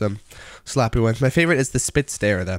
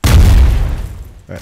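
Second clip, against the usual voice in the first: loud outburst; 10 dB louder than usual. Real, not acted. Loud outburst mid-game.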